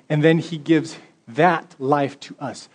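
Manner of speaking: usual speaking voice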